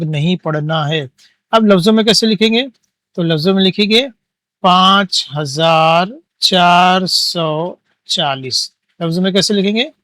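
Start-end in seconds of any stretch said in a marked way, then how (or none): 4.6-8.7 drawn out, each number stretched; very slow, deliberate teacher reading; slow dictation. Shown as a demonstration. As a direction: dictate it slowly for the class to write. Teacher dictates answer while writing.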